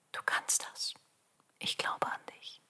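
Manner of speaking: soft unvoiced whisper. Shown as a demonstration. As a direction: whisper it encouragingly. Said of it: "und flüstere". (geflüstert)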